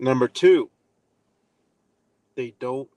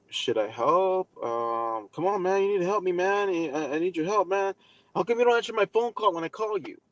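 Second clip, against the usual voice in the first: guilt-tripping friend; 7 semitones higher than usual. Retold or imitated.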